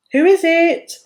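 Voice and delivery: called out loud and high toward the door; calling out. A narrator's reading. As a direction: call to the knock at the door. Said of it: "called Babushka".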